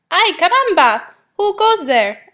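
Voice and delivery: high, loud startled cry; alarmed little hero with Spanish flair; bold challenge. A narrator's reading. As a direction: call out to challenge strangers. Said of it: "asked Skippy John Jones".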